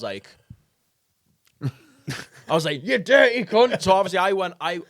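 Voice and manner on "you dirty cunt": high, loud mock outrage; shocked outburst. Retelling his outburst at the man, high loud outrage. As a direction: exclaim it high, scandalised.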